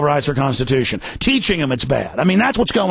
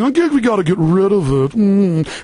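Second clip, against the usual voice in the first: mocking, slightly higher self-important voice; country club yuppie; 3 semitones higher than usual. Host imitating yuppies copying big guys, smug mocking voice. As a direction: parrot it smugly, with a closing hum. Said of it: "you're gonna hear yuppies at the country club".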